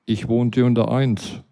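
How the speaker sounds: ordinary delivery, nothing marked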